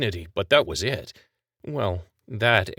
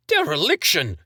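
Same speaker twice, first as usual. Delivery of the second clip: high, loud shriek of outrage; 9 semitones higher, 5 dB louder than usual. Acted. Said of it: "he shrieked".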